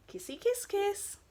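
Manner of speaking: high squeaky kissy voice; silly smooching; kissing noise. Acted. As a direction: make the kiss sound playful and squeaky. Kiss sound effect.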